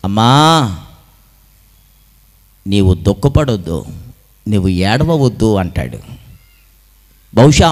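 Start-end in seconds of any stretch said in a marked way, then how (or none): 0.0-5.5 low, gentle consoling voice; compassionate. Acted. Jesus tells a grieving mother not to weep, low and compassionate. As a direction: comfort her tenderly, with pauses. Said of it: "వెంటనే కనికరపడ్డాడు".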